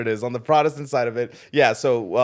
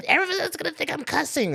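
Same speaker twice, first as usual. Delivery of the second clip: high worried mom voice; imitating mom. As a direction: squeak it like a fretting mother. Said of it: "She's gonna".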